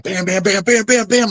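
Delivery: rising high punch noises; punch flurry. Retold or imitated. Fighter sounding out the exchange, rising high bams. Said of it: "I was like".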